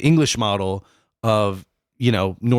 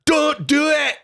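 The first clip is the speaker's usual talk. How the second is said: much higher, hammed-up Scottish yell; Scottish shout; slower than usual. Podcaster yelling a random Braveheart line, high mock Scottish shout. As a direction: shout it like a mock Highland warrior, pitch way up. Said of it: "a really good thing that you can say in a Scottish".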